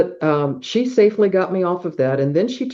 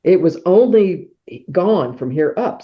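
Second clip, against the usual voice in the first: ordinary talk, nothing marked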